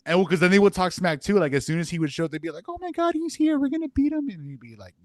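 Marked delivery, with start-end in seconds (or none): 2.7-4.3 put-on high, squealing kid taunt; excited kids. Campers hyped when the counselor shows up, squealing high. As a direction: gloat like thrilled campers spotting their rival.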